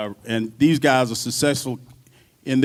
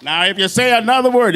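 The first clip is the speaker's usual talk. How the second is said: loud, higher warning; stern warning; 8 semitones higher, 6 dB louder than usual. Retold or imitated. Bandleader warning his drummer he'll be fired, loudly. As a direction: lay down the law. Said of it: "bandleader threatening firing".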